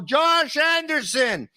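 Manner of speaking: marveling at the unlikely scorer, drawn out; stretched name call. Acted. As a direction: savor the name like a goal call. Repeats name, stretched slow.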